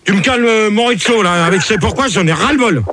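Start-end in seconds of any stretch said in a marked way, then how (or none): none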